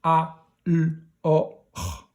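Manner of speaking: alors sound by sound, "a", "l", "o", "r". Shown as a demonstration. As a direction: decode alors one isolated sound at a time. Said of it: "que vous savez lire tout seul".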